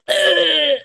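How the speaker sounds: loud strangled grunt; high, rattling choke; choking noise. Retold or imitated. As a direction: act out being choked by a seatbelt. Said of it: "like, choked myself out".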